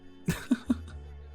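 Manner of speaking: short, airy little laugh; soft chuckle. Acted. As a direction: chuckle lightly at a guest's reaction. Amused by guest's approval.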